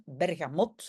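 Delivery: Bergamot said the Dutch-Flemish way, hard g, no French nasal; Flemish pronunciation. Shown as a demonstration. Teacher showing the name to her class: Flemish pronunciation. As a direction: Bergamot in careful Flemish style. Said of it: "à la flamande".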